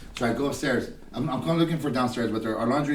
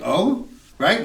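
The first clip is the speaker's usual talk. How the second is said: higher, louder mock "Oh?"; 7 semitones higher, 5 dB louder than usual. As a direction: imitate the careless "oh" with a rising tune. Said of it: "I get an "oh"?".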